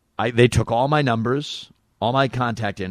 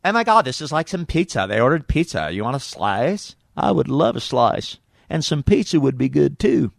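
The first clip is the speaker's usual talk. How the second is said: Monica Lewinsky; 5 semitones higher than usual. Pretend. Comic imagines Monica chatting about pizza, exaggerated cutesy voice. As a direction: start squealy, then draw the words out. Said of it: "that's how she should talk".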